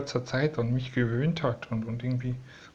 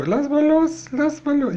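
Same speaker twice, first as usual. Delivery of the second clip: much higher pleading coax; high-pitched let go plea; 6 dB louder than usual. Real, not acted. Bird clinging to her.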